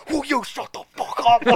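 high, laughing mock snap; intense dude, laughing. The intense guy looks up and snaps, laughing, high voice. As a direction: bark it while cracking up. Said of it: "He's like".

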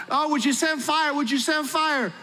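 high-pitched, hammed-up frantic cry; mock screaming plea. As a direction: imitate desperate prophets pleading upward in a high voice. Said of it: "screaming out".

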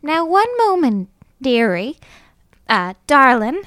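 put-on Dondalorian belle, pitch swooping high; Dondalorian accent. Disguised belle protests the confiscation, Dondalorian accent. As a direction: stall grandly in an affected Dondalorian accent. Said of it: "I affect my best Dondalorian accent".

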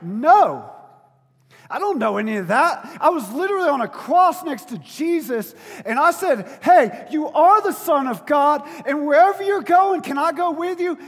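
loud, high, pleading voice of the thief on the cross; a loud, earnest retold plea; desperate plea. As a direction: beg to be taken along at full volume.

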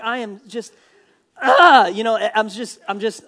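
exasperated, bursting into a loud cry; frustrated outburst. Acted. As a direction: act Jonah at breaking point, exploding on "ah". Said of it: "he's just incredibly frustrated with God".

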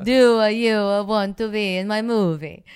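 hammed-up Italian accent retried. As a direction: push the Italian director's broken English. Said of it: "it still terrible".

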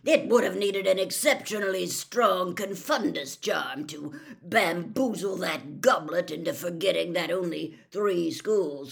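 loud, growled explanation; emphatic growled reasoning; Moody. A narrator's reading. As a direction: lay out the theory forcefully. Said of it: "said Moody".

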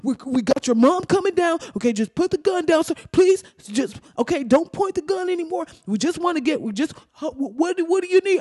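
high, anxious coaxing; pleading negotiator. Acted. Police coaxing an armed man to drop the gun, pleading.

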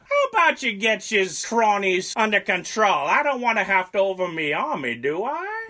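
high, loud bad British accent drifting Southern; hammed-up British bluster with a Southern twang; botched British accent, voice soaring on the last question; Churchill, accent slipping Southern. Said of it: "why did you go Southern right there?".

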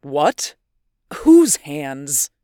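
alarmed, rising to a loud demand; jolted, pressing for an answer; shocked loud question; startled. Acted. Northwood startled by accusation.

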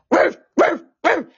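short, loud mock barks; imitated dog bark. Dreamer imitating the dog spirit, barking. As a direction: bark like a dog in quick bursts. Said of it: "barking and just".

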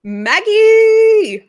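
loud high call for Maggie. Acted. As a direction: call her name loudly. Calling dog on the line.

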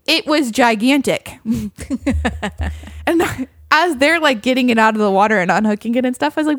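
normal talking voice

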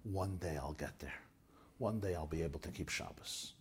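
put-on soft, hushed voice of a boy close to tears; a touch of weeping, held back; gentle retelling of a crying boy's vow; tearful. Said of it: "He started to cry and cry and he said".

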